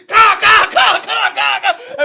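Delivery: high, shouted hitting noises; imitated rapid blows. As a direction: yell out each blow as a sound effect.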